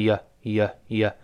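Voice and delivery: "呀" as a reduced "you" with a schwa vowel; unrounded, relaxed "ya" for "呀"; weakened you. Shown as a demonstration. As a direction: repeat "呀" as the weak form of you. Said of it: "那个you又自然会发生元音的弱化".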